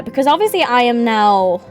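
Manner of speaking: ordinary talk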